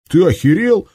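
loud, high furious outburst; enraged. Acted. Shams snaps at his commander, enraged shout. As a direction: explode at him in rage. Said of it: "взбесился Шамс".

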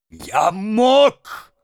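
high-pitched, anguished cry; shouting. A narrator's reading. Mendel answers his son, striking his own head, shouting. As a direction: cry it out, voice leaping up. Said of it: "закричал Мендель".

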